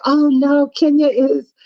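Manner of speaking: high mock-sweet loyal protest; mock defender. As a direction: play the loyal friend. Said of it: "you were supposed to say".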